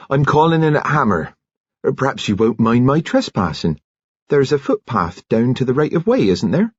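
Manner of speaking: bustling, chirpy nurse; cheerful nurse voice. A narrator's reading.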